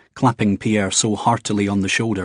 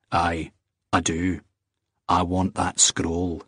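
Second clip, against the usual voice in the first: low, steady Scottish man; 3 semitones lower, slower than usual. Acted. Jamie to the wife, low and firm. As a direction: hold your ground calmly.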